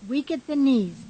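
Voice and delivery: ordinary delivery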